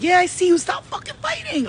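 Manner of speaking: very high-pitched mock scream; retold scream. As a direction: yell up at the clouds, voice shooting up. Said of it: "I screamed at them".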